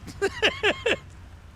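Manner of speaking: very high squeaky laugh; squealing laugh. Real, not acted.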